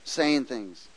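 ordinary talk, nothing marked

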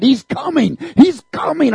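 high, loud urgent shouting; shaking people awake, shouting loud and high. Retold or imitated. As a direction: shout the news to wake everyone. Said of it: "I'm crying out".

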